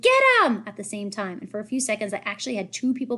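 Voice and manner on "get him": loud, high chasing scream; bully screaming an order to chase. A narrator's reading. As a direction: scream it to send the pack after him. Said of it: "someone else screamed".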